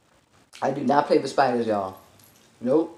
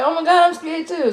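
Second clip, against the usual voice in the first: high singsong mock fright; scared friend; 13 semitones higher than usual. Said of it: "she's like".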